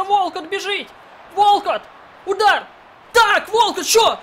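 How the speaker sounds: rising to a loud excited yell; excited shouting. Real, not acted. Gamer yelling as his striker scores. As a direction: call the attack as it breaks. Goal chance in FIFA match.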